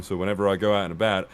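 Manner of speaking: ordinary delivery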